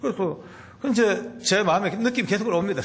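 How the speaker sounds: normal talking voice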